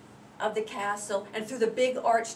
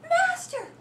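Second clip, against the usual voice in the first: much higher, louder plea for help; harp crying out; 7 dB louder, slower than usual. Acted. The golden harp calling the giant, high cry. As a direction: cry out shrilly like a magic harp. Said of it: "started to cry out".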